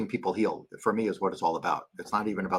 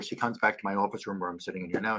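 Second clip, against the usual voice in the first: ordinary talk, nothing marked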